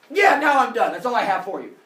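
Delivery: loud smug finish. Acted. Zeus finishing list.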